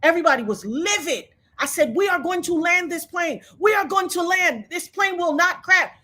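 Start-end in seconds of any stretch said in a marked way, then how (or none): 1.9-5.9 high, insistent shouted promise; retold emphatic faith-filled shout; defiant declaration. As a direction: proclaim it over the screaming.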